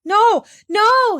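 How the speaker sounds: put-on child screaming in protest. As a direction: scream to stop them. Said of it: "screamed Heidi".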